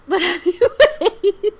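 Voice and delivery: slow, laughing it off. Real, not acted. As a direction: laugh and move on.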